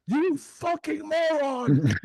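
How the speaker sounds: high, slow, drawn-out insult over a loudspeaker; imitating a fed-up state trooper; mock trooper yell. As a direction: ham up a cop cursing through a car speaker. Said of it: "State Troopers were on their hands like".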